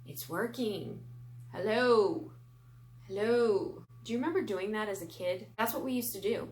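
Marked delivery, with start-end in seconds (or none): 1.5-3.8 loud hello through a spinning fan; talking into a fan. Pretend. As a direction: call into the fan blades for a robot voice. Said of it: "Speak into fans and have, like, robotic alien voices".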